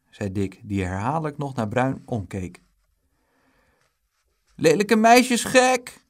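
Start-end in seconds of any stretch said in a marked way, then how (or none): 4.6-6.1 loud, high, stretched shout; drawn-out high yell carrying over distance; distant taunt. A narrator's reading. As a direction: jeer as if calling from far away. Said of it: "klonk het uit de verte".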